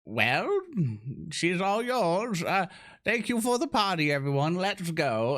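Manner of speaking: high, lilting goodbye to the hosts; slow, high, singsong hand-off; hasty party farewell. Acted. Guest handing her over to the Duergar, lilting farewell. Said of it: "look at the Duergar going".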